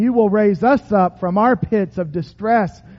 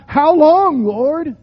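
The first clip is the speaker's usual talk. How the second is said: crying out; 7 semitones higher, 7 dB louder, slower than usual. Acted. Preacher voicing lament to God.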